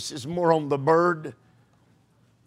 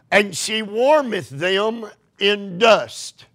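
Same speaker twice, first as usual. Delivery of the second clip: scripture reading; 4 semitones higher, slower than usual. Acted. Reads scripture, slow.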